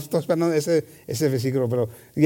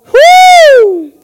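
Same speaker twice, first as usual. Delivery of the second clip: joyful whoop; 15 dB louder than usual. Real, not acted. Preacher's outburst of joy.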